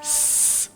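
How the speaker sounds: a long hissed س, teeth together; the s sound. Shown as a demonstration. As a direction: hold a sharp hiss on س. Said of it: "یہ آواز". (دانت ملا کر س کی سیٹی جیسی آواز)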